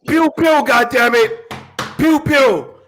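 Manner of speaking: high, loud pew pew blasts; playful pew pew sound effects; mock gun noises. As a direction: fire off cartoon laser shots.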